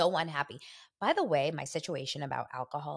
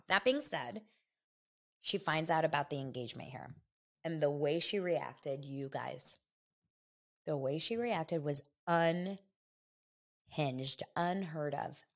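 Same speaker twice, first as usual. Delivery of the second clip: normal talking voice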